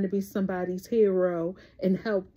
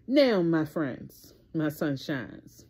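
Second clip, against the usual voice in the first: ordinary talk, nothing marked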